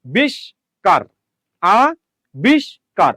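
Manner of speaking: "আবিষ্কার" as a, bish, kar, a clear gap after each; broken into syllables. Shown as a demonstration. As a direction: chop "আবিষ্কার" slowly into its three spoken syllables. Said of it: "সে উচ্চারণকে যতটুকু".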